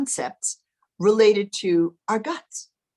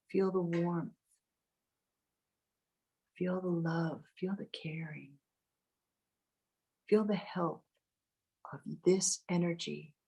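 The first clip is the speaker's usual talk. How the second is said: guided meditation; 10 dB quieter than usual. Acted. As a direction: ease them into calm, close and gentle. Leads a meditation.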